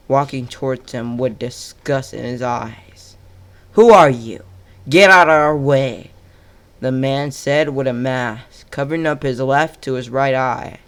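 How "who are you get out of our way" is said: angry shout. Acted. Intruder confronting Madara.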